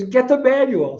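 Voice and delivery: high-pitched mock yell of a hurt child; hammed-up kid's wail, pitched high; retold yelling. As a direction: imitate a little boy yelling in pain. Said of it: "he started yelling".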